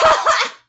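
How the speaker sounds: loud, high cackle; burst of laughter. Real, not acted. Reader amused by own mock threat, loud laugh. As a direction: crack up at your own joke.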